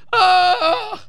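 a hammed-up, crying groan at the headline; mock anguished groan. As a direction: groan like the news physically hurts.

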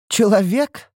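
high, incredulous rising question; derisive, high-pitched echo of the word; scornful snort. A narrator's reading. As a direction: scoff at the word in disbelief. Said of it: "фыркнула Лейтарис".

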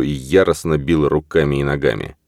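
ordinary delivery

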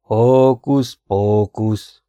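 slow, drawn-out conjuring chant; magic incantation. Acted. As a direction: intone it very slowly like a stage magician. Charmer recites a magic spell.

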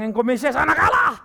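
Peter's confession called out loud. Acted. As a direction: proclaim it loud and proud, like Peter. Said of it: "Si Petrus bilang".

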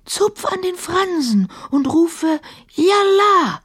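high, slow genie voice, hushed; genie whispering. A narrator's reading. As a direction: coax it out slowly and mysteriously. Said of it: "wisperte der Dschinn zurück".

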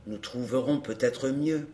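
low, soft undertone; hushed. A narrator's reading. Courrapier proposing another way round, hushed. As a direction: murmur it low and cautious. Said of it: "dit tout bas Courrapier".

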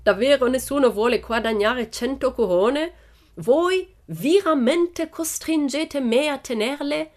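put-on high, insistent ringmaster with foreign accent; showman's loud, high appeal; circus director calling out. A narrator's reading. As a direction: goad the crowd theatrically, slowing at the end. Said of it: "gridò ancora il direttore del circo".

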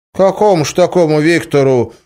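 put-on high, puzzled old peasant voice; surprised old villager. A narrator's reading. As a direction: ask in disbelief as a village grandpa. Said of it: "удивился старикан".

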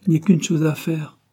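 brief, resolute declaration; curt. A narrator's reading. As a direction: state it briskly, mind made up. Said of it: "dit-elle enfin d'une voix brève".

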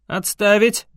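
loud sharp order; barked command. A narrator's reading. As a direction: bark it at the guards. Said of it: "гаркнул я".

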